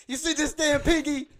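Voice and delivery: loud, high retold shout about the pinky; hammed-up furious yell; angry yell. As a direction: yell at your buddy to get moving. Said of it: "I'm like".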